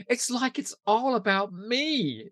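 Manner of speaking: high, slow, self-absorbed whine; Cordelia, all about me. Retold or imitated. Quoting Cordelia's vanity to her co-host, a high slow whine. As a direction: whine it like the world revolves around her. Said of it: "she goes".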